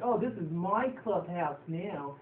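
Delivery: slow, sneering bully drawl; mock bully sneer. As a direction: stretch out crybabies with contempt. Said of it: "she ends up responding".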